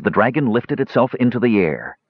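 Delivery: ordinary delivery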